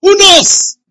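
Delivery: high, booming rhetorical question; loud challenge. Real, not acted. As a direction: shout it out to the congregation. Preacher calls out the repeated question.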